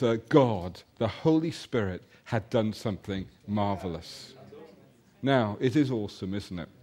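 ordinary talk

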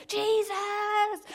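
high, loud, desperate acted cry; shouting his head off. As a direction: bellow the name over the objectors. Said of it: "he's shouting his head off".

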